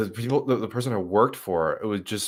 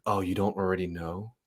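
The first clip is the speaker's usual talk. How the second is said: softer, mock-condescending question; 6 dB quieter than usual. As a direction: imitate a smug know-it-all, questioning lift at the end. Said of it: "you hear this weird condescending".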